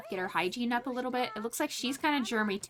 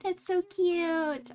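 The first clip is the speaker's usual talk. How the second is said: cooing delight; 7 semitones higher, much slower than usual. Real, not acted. Cooing over baby.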